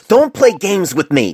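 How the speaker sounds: furious slow growl. Acted. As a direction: warn him off with a snarl. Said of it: "Painless growled".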